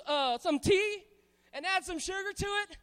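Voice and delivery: ordinary talk, nothing marked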